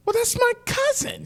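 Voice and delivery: very high, comic retold amazement; astonished exclamation. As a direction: squeal the surprise discovery. Retold astonished discovery of shared relative.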